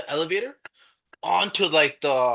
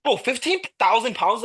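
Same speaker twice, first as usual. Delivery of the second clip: higher-pitched retold disbelief; incredulous; 6 semitones higher than usual. Friend doubting the elevator rating in his head, incredulous. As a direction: balk at an absurd figure, pitched up.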